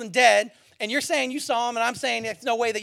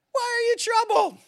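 high-pitched incredulous question; mock bafflement; 12 semitones higher than usual. Jesus questioning his shaken disciples, mock baffled. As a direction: ask it high, as if truly puzzled.

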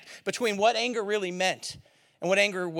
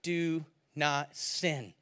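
solemn quote; 7 semitones lower, 6 dB quieter than usual. Retold or imitated. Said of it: "And he goes".

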